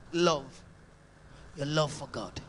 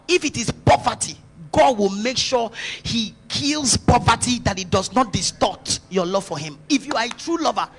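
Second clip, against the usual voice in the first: ordinary delivery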